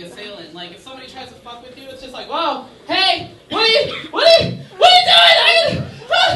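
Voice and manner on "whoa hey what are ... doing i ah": voice climbing higher and higher. Shown as a demonstration. Stunning a mugger by going higher and higher. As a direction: pitch rising to a squeaky shout. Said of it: "make my voice go, like, higher and higher".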